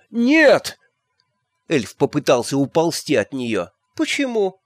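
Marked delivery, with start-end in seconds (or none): 0.1-0.8 high, frightened shriek of refusal; terrified cry. A narrator's reading. Elf shrieking at Magrat in terror of iron. 4.0-4.6 high, puzzled why; surprised. A narrator's reading. Magrat surprised that the elf refuses. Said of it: "удивилась Магрот".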